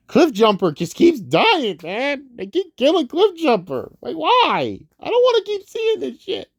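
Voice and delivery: hammed-up lament over a fallen robot; fake-sobbing complaint, pitch climbing; mock crying. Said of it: "done crying over Cliff Jumper".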